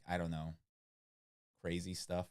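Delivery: usual speaking voice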